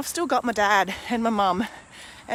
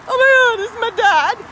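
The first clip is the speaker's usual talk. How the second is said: high, loud tearful cry; sobbing it out in a rush of emotion; high, loud voice breaking into tears; 13 semitones higher, 8 dB louder than usual. Retold or imitated. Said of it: "I literally just burst into tears".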